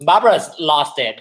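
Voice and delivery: ordinary talk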